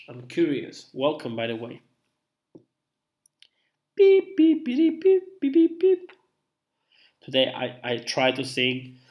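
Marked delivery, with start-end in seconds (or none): 3.9-6.1 playful beeping. Pretend. Making playful beep noises.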